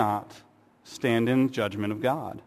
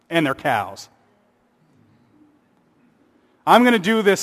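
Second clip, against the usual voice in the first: sarcastic fawning apology; 3 semitones higher, 5 dB louder than usual. Pretend. Preacher mocking an apologetic God, sarcastic. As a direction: ham up a syrupy, sarcastic apology. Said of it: "doesn't coddle Joseph ... apologize for making him angry".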